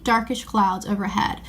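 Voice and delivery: ordinary delivery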